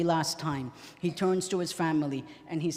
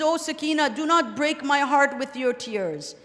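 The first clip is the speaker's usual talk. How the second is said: anguished plea; 7 semitones higher, 6 dB louder than usual. Retold or imitated. Father begging his daughter to stop crying, anguished plea.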